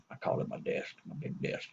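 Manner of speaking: unvoiced whisper, like a secret. Bragging about the desk in a sly whisper. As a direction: let the punchline drop to a hush. Repeats the phrase, dropping to a whisper.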